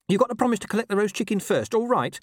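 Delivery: high, urgent dog's plea. A narrator's reading. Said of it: "said Gaspode urgently".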